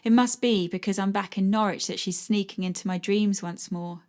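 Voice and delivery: ordinary delivery, nothing marked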